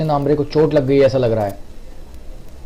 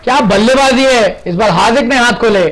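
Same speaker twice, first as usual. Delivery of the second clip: excited commentary; 6 semitones higher than usual. Real, not acted. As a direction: shout the six with joy. Sixes rain, commentator elated. (जोशीली commentary)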